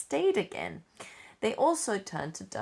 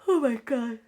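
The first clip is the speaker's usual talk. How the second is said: talking through a yawn, higher and louder; real yawn; 6 semitones higher, 7 dB louder than usual. Real, not acted. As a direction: let a yawn overtake the apology. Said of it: "that's a yawn".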